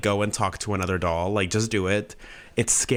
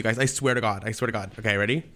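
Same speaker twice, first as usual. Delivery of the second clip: ordinary talk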